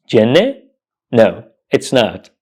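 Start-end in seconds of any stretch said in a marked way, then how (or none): 0.1-0.6 "jenne" as JEN-nuh, final e sounded; "jenne" with a short first vowel; wrong pronunciation, asked. Shown as a demonstration. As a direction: question "jenne" in two syllables. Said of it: "Is it pronounced jenne?".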